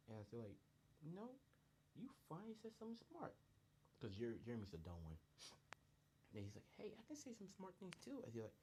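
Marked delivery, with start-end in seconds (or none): 1.0-3.3 very high, teasing voice; acted high-pitched backhanded praise; teasing mock amazement. Friends tease the dumb one. 6.8-8.2 very high, indignant character voice; mock-offended, squeaky-high dimwit; dumb one protesting.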